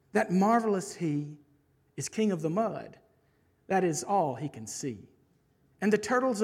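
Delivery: animated rhyming narration, settling down at the close; lilting picture-book storyteller; story reading. Acted. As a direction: tell it to children, building then slowing to the moral. Reads a rhyming children's book aloud.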